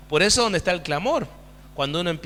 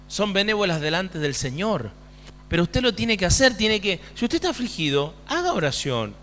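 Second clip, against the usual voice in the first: ordinary delivery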